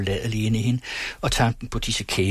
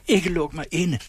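much higher, begging in fear; fearful pleading cry; 7 semitones higher than usual. Acted. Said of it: "råbte fru Syverts angstfuldt bedende".

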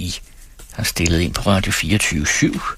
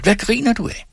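much higher, upset accusing question; indignant cry; 14 semitones higher than usual. A narrator's reading. Lilian snaps at Hans for laughing, high and upset. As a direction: demand it, stung and upset. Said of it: "råbte hun oprørt".

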